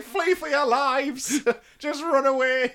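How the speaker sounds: high, loud, hammed-up call to flee; mock panicked shout. Podcaster imitates a king abandoning his men, mock panicked shout. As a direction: yell it like a mock-hysterical leader, drawn out. Mimicking the panicking king's orders.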